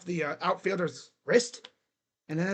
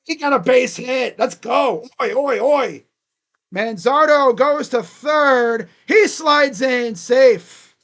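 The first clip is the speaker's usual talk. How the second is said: loud, high, elated hit call; real fan-announcer excitement; excited play-by-play; 5 semitones higher, 10 dB louder, slower than usual. Real, not acted. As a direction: shout the runner home, stretching the words. Live call of base hit.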